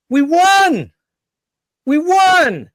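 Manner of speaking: high, loud mock-triumphant cheering; sarcastic over-the-top victory voice; mock celebration. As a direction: gush like a duped citizen celebrating a fake win.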